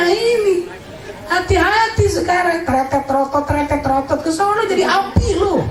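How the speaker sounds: high squeaky taunting threat; high teasing flame voice; mocking hell voice. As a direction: cackle at the sinner as the fire.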